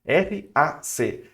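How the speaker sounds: very slow letter by letter; spelled out. Shown as a demonstration. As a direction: spell "R A C" out slowly. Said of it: "O que que é o método hack?".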